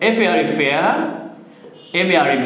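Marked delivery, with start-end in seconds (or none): 0.6-0.8 "fair" said as fea, without the R; silent final R. Shown as a demonstration. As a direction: finish "fair" on an open vowel, dropping the R. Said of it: "আমরা আর উচ্চারণ করবো না". ("fair" শব্দের শেষে র অনুচ্চারিত)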